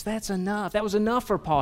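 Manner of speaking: ordinary talk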